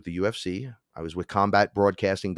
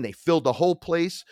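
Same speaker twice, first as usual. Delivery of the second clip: ordinary talk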